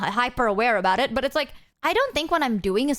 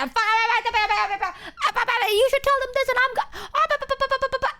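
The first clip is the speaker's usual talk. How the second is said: babbling friends; 12 semitones higher, much faster than usual. Retold or imitated. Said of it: "I'm gonna".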